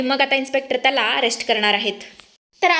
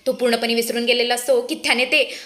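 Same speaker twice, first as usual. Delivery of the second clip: ordinary delivery, nothing marked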